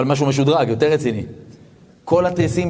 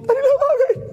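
much higher, strained, crying voice; starting to cry. Retold or imitated. Huge lonely man starts to cry before the rabbi, weeping. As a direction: break down into tears as you say it. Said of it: "מתחיל לבכות".

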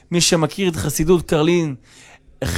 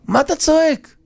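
high-pitched mock yell; agitated protest; 10 semitones higher than usual. Host demands to know why guest yells, agitated. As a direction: raise the pitch into an exclamation.

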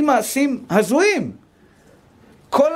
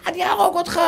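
higher, mock wild kid's threat; mock child threat; 8 semitones higher than usual. Rabbi imitates a wild kid threatening his brother, mock high voice. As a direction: play a rampaging child, half-joking. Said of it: "אתה רואה את הילד משתולל".

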